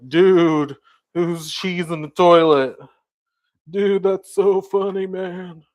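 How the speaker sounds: loud, hyped gamer dude; gamer bro voice. Retold or imitated. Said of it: "gamer bros be like".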